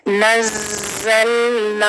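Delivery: नज़लना slow with the ज़ held long; correct tajweed. Shown as a demonstration. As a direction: hold the shadda in नज़लना. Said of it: "अलिफ की वजह से मद बन रहा है".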